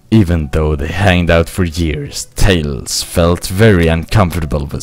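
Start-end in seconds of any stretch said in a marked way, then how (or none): none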